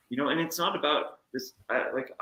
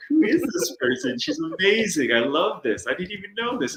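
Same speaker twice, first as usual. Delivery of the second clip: higher and a touch louder, amazed at a new friend; delighted disbelief; 7 semitones higher, 3 dB louder than usual. Retold or imitated. Recalling hearing her talk backstage, gushing in delight. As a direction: gush like you just found your favourite person.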